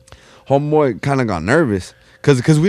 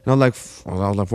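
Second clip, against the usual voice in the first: usual speaking voice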